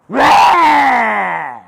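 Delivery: high, loud mimicked roar; roaring back. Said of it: "And I go".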